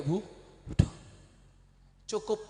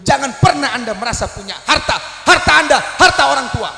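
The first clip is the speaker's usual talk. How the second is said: forceful preaching; 8 semitones higher, 15 dB louder than usual. Acted. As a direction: drive the lesson home. (khotbah lantang tentang harta orang tua)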